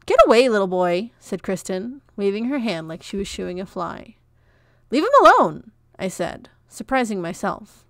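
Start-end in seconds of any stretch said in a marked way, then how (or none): 0.1-1.1 dismissive shooing. A narrator's reading. Shooing a pesky boy away. 4.9-5.7 much higher and louder, blurted out; sudden outburst. A narrator's reading. Comfort defending Peach from Kristen, a sudden loud outburst.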